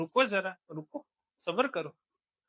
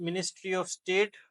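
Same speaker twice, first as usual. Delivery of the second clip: slow dictation; much slower than usual. Shown as a demonstration. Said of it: "teacher dictating".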